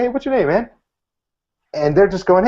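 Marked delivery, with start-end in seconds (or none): 0.0-0.7 higher, bright, buddy-style hey; like meeting an old friend; easygoing greeting. Shown as a demonstration. Magician shows how he greets a stranger, casual and bright. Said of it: "I can walk up to anyone and say".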